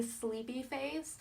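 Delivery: ordinary talk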